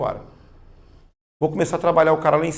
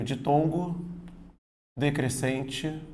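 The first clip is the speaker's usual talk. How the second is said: slow emphasis; 6 dB quieter, slower than usual. Shown as a demonstration. Teacher stressing the term.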